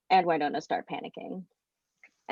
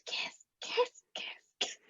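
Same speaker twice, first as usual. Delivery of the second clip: insistent voiceless ghost chant; repeated whisper; 11 dB quieter, slower than usual. Acted. Ghost pushing two friends together, chanting in a whisper. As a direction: whisper it over and over like a haunting. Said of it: "they just hear the whispers".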